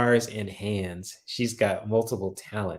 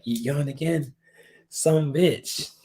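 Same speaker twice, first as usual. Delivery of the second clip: higher, playful telling-off; mock scolding; 6 semitones higher, slower than usual. Imagining a viewer popping her on the head, mock scolding. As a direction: imitate an exasperated friend, punching the last words. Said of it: "you'll probably pop me on the head".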